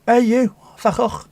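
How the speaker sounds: high mock yell of "Van Gogh" with a throaty ch; mock telling-off. Streamer joking to chat, mock yell. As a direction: shout the name like a rude brush-off. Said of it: "sounds like you're telling someone".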